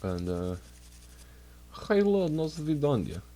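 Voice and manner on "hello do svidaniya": higher-pitched mock Russian accent; playful, hammed-up Russian greeting; silly fake Russian accent. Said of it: "silly Russian fake accent".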